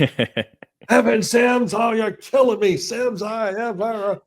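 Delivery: a drawn-out, high-pitched coach's lament; exasperated coach. Retold or imitated. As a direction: ham up the coach groaning at "F and Sims". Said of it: "I was F and Sims for eight years".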